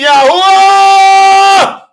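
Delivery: long, high, loud drawn-out scream; screaming the name. Retold or imitated. Preacher showing how neighbors hear him scream. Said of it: "they hear me screaming".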